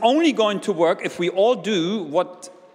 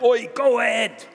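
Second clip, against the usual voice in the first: higher mock herding call; pushy shepherd; 5 semitones higher than usual. Shepherd pushing sheep from behind, mock pushy call. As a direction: drive them on from behind, hammed-up. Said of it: "the shepherd doesn't push the sheep like".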